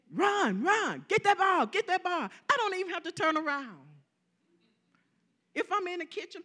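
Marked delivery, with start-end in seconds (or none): 0.1-2.3 higher, louder mock football yell; imitating husband yelling. Wife imitates her husband yelling at football, mock shout. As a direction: play the husband cheering at the TV. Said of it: "he's screaming".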